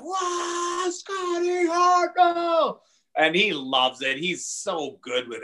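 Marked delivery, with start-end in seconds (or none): 0.0-2.8 very high, drawn-out amazed cry; awestruck fan. Retold or imitated. Crowd parting for Hartnell at the rink, crying out in awe. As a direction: stretch it out like a starstruck crowd. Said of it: "everyone like".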